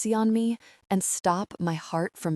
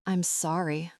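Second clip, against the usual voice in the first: ordinary delivery, nothing marked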